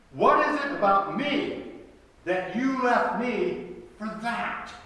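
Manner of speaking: high, loud, wounded disbelief; incredulous challenge. Acted. God asking his people why they left, incredulous challenge. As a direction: press the question hard, punching ME and THAT. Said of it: "God is asking his people".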